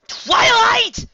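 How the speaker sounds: high loud scream of her name. A narrator's reading. Rainbow Dash screams Twilight's name at the door. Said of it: "Rainbow Dash screamed".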